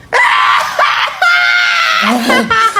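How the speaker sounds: high, loud cackling gossip laugh; loud shrieking laugh. Acted. Mothers gossiping, loud high cackle. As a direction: cackle like a noisy kumare.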